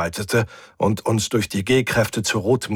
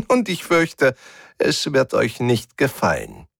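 high malicious giggle running into words; giggling; 6 semitones higher than usual. A narrator's reading. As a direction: gloat with a high-pitched titter. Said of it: "Ein leises Kichern ertönte". (hämisch kichernd)

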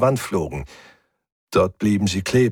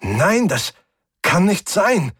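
disbelieving; 10 semitones higher, slower than usual. A narrator's reading. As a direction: deny it as panic climbs. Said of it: "entgegnete die Stimme".

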